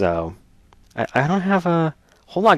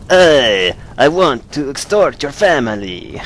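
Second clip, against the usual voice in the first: loud, raised-pitch mock Italian mobster; hammed-up Italian accent; 5 semitones higher, 5 dB louder, slower than usual. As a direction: belt out a bad Italian mafia accent. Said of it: "that one came out more Italian".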